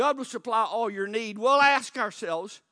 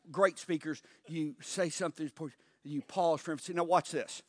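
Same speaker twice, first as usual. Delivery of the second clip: ordinary talk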